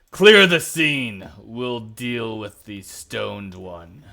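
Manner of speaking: slow, loud commanding boom. Acted. Guard captain ordering the crowd away, slow booming voice. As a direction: boom out orders to clear the street. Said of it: "you hear this guy's booming voice".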